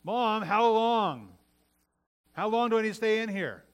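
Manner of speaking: put-on high, loud kid's complaint; bad attitude. Kid sent to his room calling to mom, bad attitude. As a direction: drag it out like an impatient kid. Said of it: "in my bad attitude".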